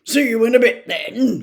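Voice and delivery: loud jovial goodbye; hearty farewell. A narrator's reading. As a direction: boom it cheerfully. Vernon cheery farewell.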